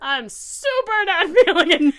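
high, loud, laughing rejection; laughing through it. Retold or imitated. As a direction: laugh it out as you let him down.

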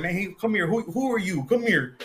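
high, challenging hey come here; imitating Mexican locals. Locals in Mexico stopping him, high challenging call. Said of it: "they be like".

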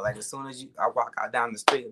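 normal talking voice

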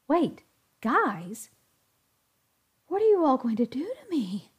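a touch of nervous alarm, rising; anxious question, voice climbing. A narrator's reading. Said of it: "asked Zach nervously".

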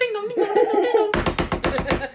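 very high sing-song chime; playful high-pitched ding-dong; imitated doorbell. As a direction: mimic a doorbell, high and loud.

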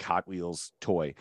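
usual speaking voice

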